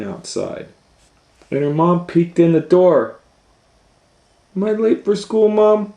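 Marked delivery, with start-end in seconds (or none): 4.6-5.9 put-on high, anxious child; little girl voice. A narrator's reading. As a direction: ask mom worried. Kate asks.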